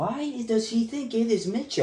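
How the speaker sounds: high-pitched indignant protest. Real, not acted. Podcaster complaining his name was misread, indignant. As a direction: protest in disbelief, voice shooting up.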